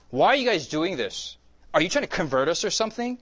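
high, loud imitation of a heckler; mock indignant challenge. Heckler confronting campus evangelists, mock indignant. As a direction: demand answers, hammed up.

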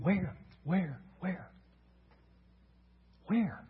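soft, puzzled question with pauses between; imitating a bewildered crowd, softly; hushed searching. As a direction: ask it quietly, as if looking around. Voicing the crowd searching around.

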